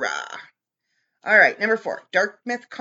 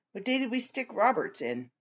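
normal talking voice

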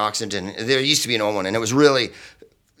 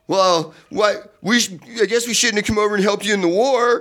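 much higher, mocking jibe; snide British heckler; 9 semitones higher than usual. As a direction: jab at the Yank. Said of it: "when you're like".

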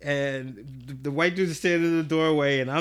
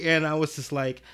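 ordinary delivery, nothing marked

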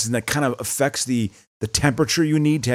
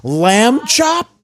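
high, loud disbelieving question; incredulous squeal; 12 semitones higher, 5 dB louder than usual. Real, not acted. Reacting to a dated guest with a high squeal. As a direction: squeal the name in amused disbelief.

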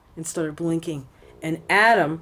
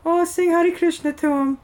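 high-pitched mock Indian accent; bad Indian accent; 11 semitones higher, 3 dB louder than usual. Temple lady urging a reluctant daughter to sing, bad Indian accent. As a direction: put on a poor Indian accent, pitched right up. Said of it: "I'm trying to be her Indian".

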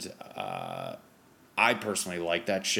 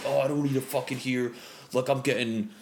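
higher-pitched mock indignation at being critiqued; offended, mock mad; 5 semitones higher than usual. Comic mimicking a touchy performer hearing feedback, mock offended. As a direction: imitate someone bristling, voice pushed up. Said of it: "some people just get so mad".

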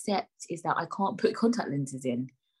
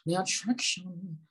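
ordinary talk, nothing marked

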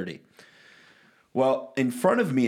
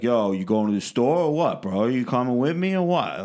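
put-on New Yorker street talk; exaggerated New York accent; fake New York accent. Shown as a demonstration. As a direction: play someone faking a New York accent. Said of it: "I put on this fake accent".

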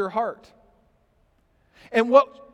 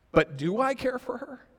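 ordinary talk, nothing marked

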